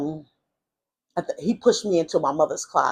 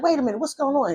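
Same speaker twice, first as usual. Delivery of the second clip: high, urgent mother imitation; mock-alarmed questioning at normal volume; 9 semitones higher, faster than usual. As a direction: retell mom breaking in, pitched up. Mother demanding an explanation, retold.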